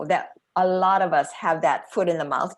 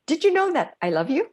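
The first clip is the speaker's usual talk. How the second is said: playful question; 9 semitones higher, 4 dB louder, much faster than usual. Shown as a demonstration. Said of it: "go around to your family tonight and say".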